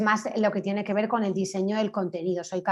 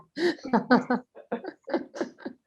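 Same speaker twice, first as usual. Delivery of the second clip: high chuckling laugh, trailing away; amused laugh; 4 semitones higher than usual. Real, not acted. Panelist laughing with the group, amused laugh. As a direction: laugh on at your own joke.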